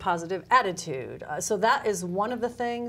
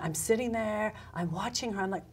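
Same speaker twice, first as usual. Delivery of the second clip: usual speaking voice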